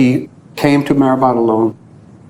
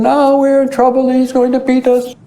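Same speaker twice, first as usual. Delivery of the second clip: high mock frightened wail; scared boys; 12 semitones higher than usual. Frightened boys fear Baba will beat them, high mock fear. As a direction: play the terrified boys.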